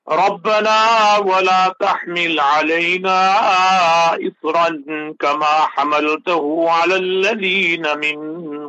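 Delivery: Quran recitation. Acted. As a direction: recite with measured, chanted phrasing.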